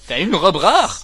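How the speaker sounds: loud, drawn-out astonished question; hammed-up amazement; mock amazement. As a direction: blurt it out, stretching the words. Mock amazement at rare item.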